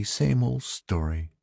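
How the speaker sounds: high, soft and slow, as the wife; sympathetic wife. A narrator's reading. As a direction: voice the wife's resigned sympathy, gently drawn out. Wife sees their dejected faces.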